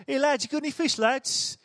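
lightly voiced cheery tourist calling out; tourist accent. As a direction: call over the water like a friendly stranger. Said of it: "Maybe he put an accent on again".

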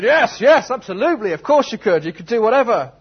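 high, loud, gushing yes; overexcited spectator, all in; eager agreement. Acted. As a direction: agree with hammed-up enthusiasm. Acting the Duke's eager agreement.

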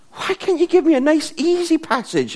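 high-pitched mock whine of protest; exaggerated "why me" wail; plaintive complaint. Acted complaint about given subject.

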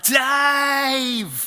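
high, loud call of "Dave!"; shout. Retold or imitated. Shouting to Dave at the far end of the park. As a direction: yell his name across the park. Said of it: "I shouted and I went".

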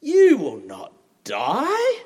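slow, drawn-out sly denial; sly snake. Acted. Preacher voicing the serpent tempting Eve, slow and sly. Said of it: "What does the snake say?".

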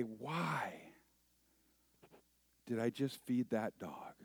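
acted rueful hush; sighing regret. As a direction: ask yourself with a weary sigh. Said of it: "And I sigh. And I say".

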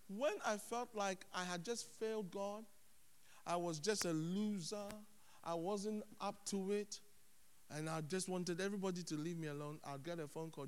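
ordinary talk, nothing marked

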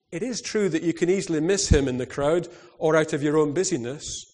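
plain reading voice

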